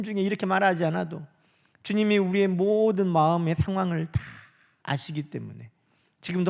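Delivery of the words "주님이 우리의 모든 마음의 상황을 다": ordinary talk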